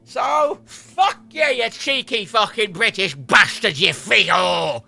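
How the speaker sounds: loud, drawn-out hammed-up abuse; ranting outburst. Irish streamer cursing the British, hammed-up furious yell. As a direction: yell it slowly, relishing each insult. Abusing the British after famine rant.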